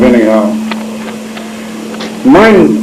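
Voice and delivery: ordinary talk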